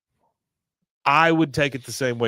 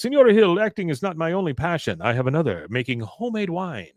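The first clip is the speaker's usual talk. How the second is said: put-on Mexican accent; 6 semitones higher than usual. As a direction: ham up a courtly Mexican accent. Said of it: "He goes".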